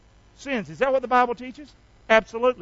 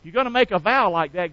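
normal talking voice

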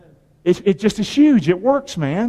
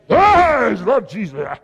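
in-your-face believer; slower than usual. Retold or imitated. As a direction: get right up in their face with it. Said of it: "if you're up like this in their face".

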